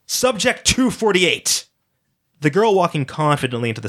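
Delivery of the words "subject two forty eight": slow, high, drawn-out announcement; auctioneer call. Acted. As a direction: call the lot like a showman.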